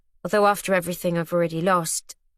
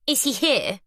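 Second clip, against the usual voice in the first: looking down her nose; 5 semitones higher than usual. Acted. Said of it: "lifting her chin and looking down her nose".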